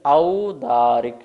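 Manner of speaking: औदारिक said slowly with a full a-u; teacher correcting औदारिक; clear au sound. Shown as a demonstration. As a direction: stress the औ of औदारिक. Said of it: "ओ नहीं है, ध्यान रखेंगे".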